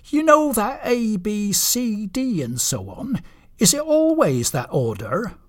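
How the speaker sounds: high-pitched, hesitant questioning; high character voice, easing down; puzzled reindeer Blitzen. Acted. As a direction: ask as a reindeer with a nagging doubt.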